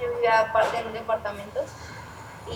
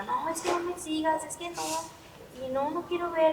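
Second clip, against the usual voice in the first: mildly hysterical, higher-pitched pleading; higher-voiced, understated mock hysterics; retold hysterical refusal; 6 semitones higher, 4 dB quieter than usual. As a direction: imitate yourself refusing hysterically, without shouting. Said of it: "pues, bien histérica".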